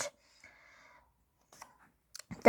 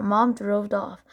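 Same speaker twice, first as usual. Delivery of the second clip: ordinary delivery, nothing marked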